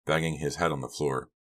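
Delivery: ordinary delivery, nothing marked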